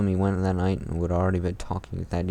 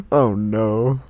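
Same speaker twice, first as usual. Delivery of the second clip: gasping, louder and higher; mock gasp; 5 semitones higher, 6 dB louder than usual. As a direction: feign shock at the plot twist. Mock shock at fanfic twist.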